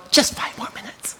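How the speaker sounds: soft, voiceless murmur to oneself; hushed inner thought. A narrator's reading. Penny putting off the shepherd's call, hushed. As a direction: whisper it privately, as a stolen thought. Lamb's stubborn thought while eating.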